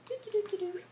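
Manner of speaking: light sung doo-doo; sung hopping notes. Acted. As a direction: hum it as he hops. Hopping sound effect.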